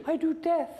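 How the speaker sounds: comically high, squeaky villain; Darth Vader. Acted. Coach spoofs a Star Wars villain, high and squeaky. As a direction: squeak the menace away. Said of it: "Darth Vader's voice was a little bit different".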